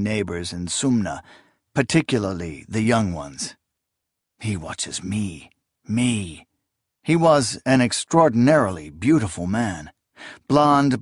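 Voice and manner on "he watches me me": soft inner thought. Acted. Esmenet's private thought.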